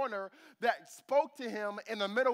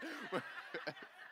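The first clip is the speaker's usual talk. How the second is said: real laugh; 9 dB quieter than usual. Real, not acted. Laughs at own impression.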